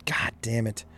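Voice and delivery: under the breath; muttered curse. Real, not acted. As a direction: grumble it to yourself. Hiccup again.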